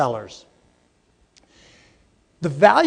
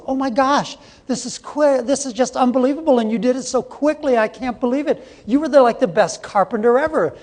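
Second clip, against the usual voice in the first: higher, gushing amazed customer; amazed lady; 6 semitones higher than usual. Retold or imitated. Delighted lady praises the carpenter, higher. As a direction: rave at the carpenter, pitched up. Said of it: "The lady goes".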